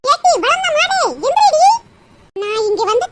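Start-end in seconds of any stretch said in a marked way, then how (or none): 0.0-1.6 scolding yell. Acted. Mother-in-law rousing her sleeping daughter-in-law, scolding yell. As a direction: yell to rouse a lazy sleeper. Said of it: "காட்டு கத்த கத்திக்கிட்டு இருக்கேன்".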